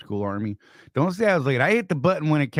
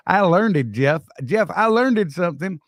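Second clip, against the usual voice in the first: louder, higher, playfully insisting; mock proud boast; 6 semitones higher, 5 dB louder than usual. Real, not acted. Host bragging to Jeff that he learned.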